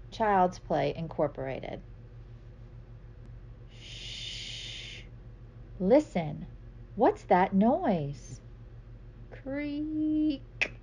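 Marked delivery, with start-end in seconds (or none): 3.8-5.0 soft breathy shush, barely voiced; whispered shush. Hushing in bedtime story. 5.8-8.4 slow curious question. Acted. Story refrain, curious listening. 9.3-10.7 high creak, voice drawn out; creaky door sound. Acted.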